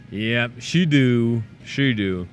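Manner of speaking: slow put-on country "she do"; folksy drawl. Teasing Betsy in folksy voice.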